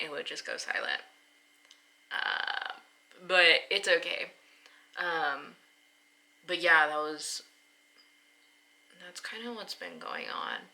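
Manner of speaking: ordinary talk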